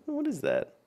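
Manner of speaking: high laughing question. Real, not acted. Laughs at odd in-game staff.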